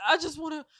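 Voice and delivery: high, stunned; words fail you mid-sentence; mock shocked. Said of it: "You're like".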